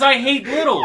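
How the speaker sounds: loud, high, laughing quote; laughing shout. Retold or imitated. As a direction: shout it while cracking up. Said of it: "He says".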